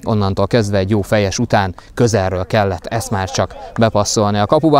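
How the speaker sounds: ordinary talk, nothing marked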